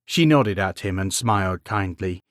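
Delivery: usual speaking voice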